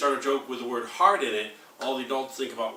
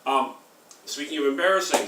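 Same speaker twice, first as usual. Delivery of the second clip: normal talking voice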